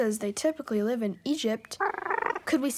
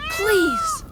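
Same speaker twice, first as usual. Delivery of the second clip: pleading; 7 dB louder than usual. Acted. Begging to be trusted.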